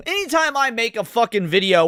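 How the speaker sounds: ordinary talk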